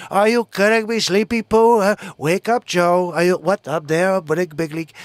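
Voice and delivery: put-on presidential impression voice; comic impression of a ranting president; Donald Trump. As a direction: do a mocking politician impression. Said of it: "Bubba says he likes my Trump impression".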